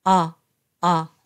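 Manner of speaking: low-pitched "あ" between Japanese o and a; short, low "あ" with relaxed lips; open back vowel. Shown as a demonstration. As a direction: say "あ" from the back of the throat, jaw dropped. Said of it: "喉の奥の方で発声します".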